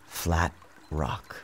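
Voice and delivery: usual speaking voice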